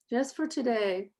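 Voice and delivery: measured English principles with long pauses between lines; slow recitation. Shown as a demonstration. Teacher leading the class in the English principles, slow and calm. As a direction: recite each line for the class to echo. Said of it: "We're gonna say them slowly".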